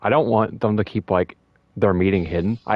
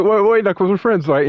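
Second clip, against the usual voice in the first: high-pitched, acted backpedaling; nervous pleading; 11 semitones higher, 4 dB louder than usual. Eggman begging Knuckles as friends, nervous pleading. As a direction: plead for mercy, hammed up.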